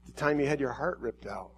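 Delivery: soft, hushed, lingering words of a loving God; tender voice of God. Acted. God speaking softly to the crowd, tender and hushed. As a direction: speak as God gently calling his people. Preacher voicing God to congregation.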